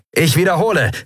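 high-pitched repeat of a summons; urgent call. A narrator's reading. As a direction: call it into the speaking tube. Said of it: "rief Sephiron in eines der Sprechrohre". (dringend gerufen, hoch)

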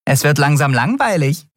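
high, teasing little voice; amused. A narrator's reading. Nelen teases Miko after winning, amused. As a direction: tease the loser lightly. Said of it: "sagte Nelen amüsiert".

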